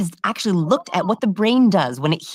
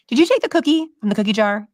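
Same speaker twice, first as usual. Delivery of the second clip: lightly voiced parent, pitched higher; higher, pointed question to a child; 6 semitones higher, 3 dB louder than usual. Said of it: "If I say to my daughter".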